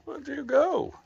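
sing-song puppy talk; pet voice. Real, not acted. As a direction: coo it up high to the dog. Cooing to her pets.

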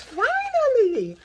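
finally cried out high, loud and slow; a long-awaited sigh of triumph; overjoyed finally. Real, not acted. Celebrating long-awaited orange bags.

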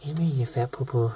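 high, loud doting call to a pet; cooing pet talk. Acted. Owner cooing at her pet roach Mia, doting pet talk. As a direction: coax the bug over like a baby.

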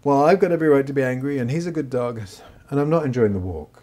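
angry inner part answering the scolding; mild mock indignation. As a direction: grumble back as the angry part. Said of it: "the angry part would be like".

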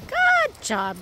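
very high sing-song good job; squeaky praising a dog. Real, not acted. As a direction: coo praise at the dogs. Praising dogs.

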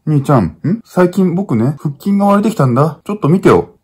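put-on high, eager childlike boasting; little brother proudly showing his abs; proud kid brother. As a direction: show off to big brother like a little boy. Skit, boy shows off abs.

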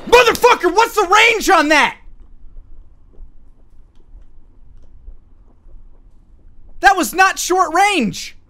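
high, loud outraged yell; outraged shout. Real, not acted. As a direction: yell it out in disbelief. Outraged after being killed.

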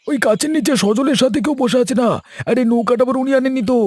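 high, wondering villager voice; an eager villager urging the others; curious villager. Acted. (উঁচু গলায় কৌতূহলী গ্রামবাসী)

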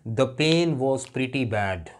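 slow classroom example; slow example sentence. Shown as a demonstration. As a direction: read out very slowly word by word. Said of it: "अब pretty क्या खूबसूरत नहीं है?".

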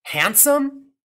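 high, loud incredulous echo. A narrator's reading. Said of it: "as if she had lost her reason".

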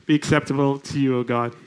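low, subdued prayer voice. Real, not acted. Preacher praying to God before opening the scripture, hushed prayer. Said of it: "let me just pray very quickly".

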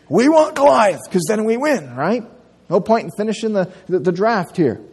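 eager team captain. Pretend. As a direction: blurt it out like a kid choosing first. Kid picking teams voiced.

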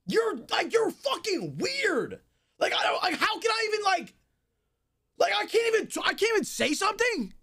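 screaming furious rant; yelling at the chat in rage; berserk rage. Real, not acted. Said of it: "as I'm screaming".

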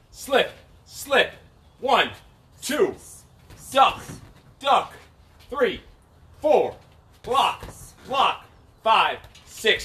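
calling each move, sharp; boxing coach calling combos. Real, not acted. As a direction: bark out the combo counts.

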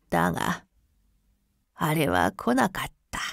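put-on softly trembling old voice; shaky old man. A narrator's reading. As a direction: let the voice quiver a little. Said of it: "老人の声はかすかに震えを帯び".

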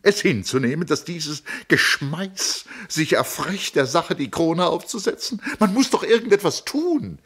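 high, loud, urgent pleading; agitated pleading sister. Acted. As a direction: press him desperately to act. Tony pleads agitatedly with Tom.

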